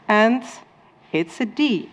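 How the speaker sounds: normal talking voice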